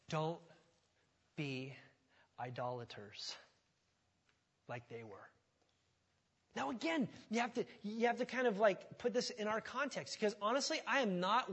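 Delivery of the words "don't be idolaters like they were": softer, lower, word by word; solemn warning. Shown as a demonstration. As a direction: space each word as a grave warning. Quoting Paul's warning slowly.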